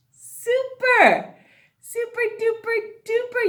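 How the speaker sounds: high, loud burst of praise; excited praise. Real, not acted. Teacher cheering her class, excited.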